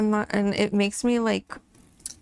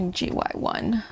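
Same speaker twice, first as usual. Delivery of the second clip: whispered code. Real, not acted. Reading a color code aloud.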